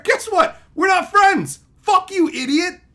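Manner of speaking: high, loud outburst; angry telling-off. Real, not acted. Streamer rejecting rude commenters, angry and loud. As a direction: cut them off with a rising yell.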